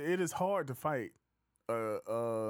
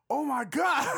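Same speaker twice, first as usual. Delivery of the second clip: much higher and louder, scandalized squeal; shocked exclamation; 13 semitones higher, 10 dB louder, slower than usual. Retold or imitated. Spanish girl hearing the rude phrase, shocked high exclamation. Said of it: "And then she says".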